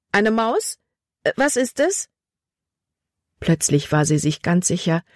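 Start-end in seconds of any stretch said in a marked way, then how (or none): 0.1-2.1 higher, startled and scared; frightened girl. Acted. Libby startled by something in the dark: scared high voice. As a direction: ask it jumpy with fright.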